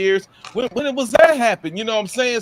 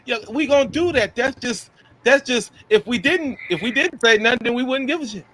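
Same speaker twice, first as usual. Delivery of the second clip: ordinary delivery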